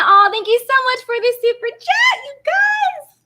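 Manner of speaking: squeaky high-pitched thanks, half shouted; goofy high voice. Pretend. Thanking viewers for super chats in a squeaky voice. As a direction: play the dork with a silly high voice. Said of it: "I'm such a dork".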